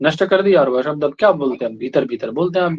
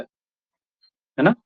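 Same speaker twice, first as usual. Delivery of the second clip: usual speaking voice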